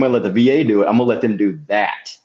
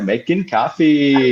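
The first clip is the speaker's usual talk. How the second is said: slow, stretched-out, higher sing-song; Rob Schneider's SNL copy guy; 4 semitones higher, 3 dB louder, much slower than usual. Acted. Quoting himself by the office coffee machine, sketch impression. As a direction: draw it out like an office pest. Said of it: "I'm the coffee guy".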